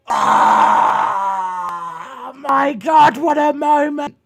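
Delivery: high, loud shout of delight; thrilled fan yelling; excited shout. Real, not acted. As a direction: shout it like the crowd just popped. Imagining the moment.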